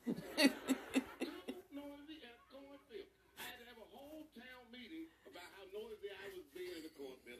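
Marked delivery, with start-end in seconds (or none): 0.1-3.1 drawn out slowly, louder, laughing through it; laughing disbelief. Real, not acted. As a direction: laugh at how absurd it is.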